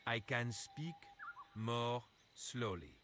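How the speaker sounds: drawn-out, slow delivery. Shown as a demonstration. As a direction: stretch each word out slowly. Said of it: "can you speak very, very slowly?".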